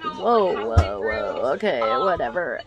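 low, drawn-out whoa; dismissive. Retold or imitated. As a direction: brush it off, unimpressed. Retold parents brushing off warning.

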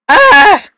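loud, high-pitched yell; high scream. Real, not acted. Player failing a level, high scream. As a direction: shriek at the mishap.

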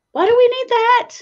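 high, loud indignant outburst; comically aggrieved high wail; furious. Real, not acted. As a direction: protest in squeaky, exaggerated outrage. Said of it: "I'm furious".

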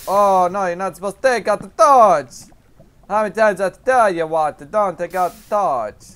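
loud, high mock-Italian scolding; Italian-esque accent. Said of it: "Why am I doing this Italian-esque accent?".

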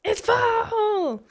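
thrilled cry; delighted squeal. Real, not acted. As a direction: cheer the season in. Season finally arrives.

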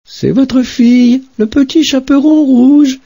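very high put-on little girl voice; faked girl's voice. Wolf at grandmother's door faking the girl's voice, very high. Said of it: "en contrefaisant sa voix".

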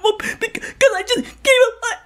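high-pitched tearful wail; acted crying. Passenger explaining to God why she is crying, tearful wail. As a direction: wail the excuse through mock sobs. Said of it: "Why are you crying?".

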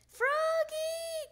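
long, high, loud call; father summoning his child. A narrator's reading. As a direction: holler the name as if across the house. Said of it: "called his father".

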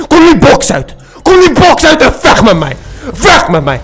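high, loud laughing shout; loud laughing challenge; laughing yell. Real, not acted. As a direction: yell at him to come out, laughing. Shouting at opponent in box.

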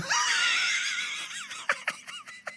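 high squealing burst of laughter; shrieking laugh. Real, not acted. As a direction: howl with laughter, voice cracking up. Laughs at funny character.

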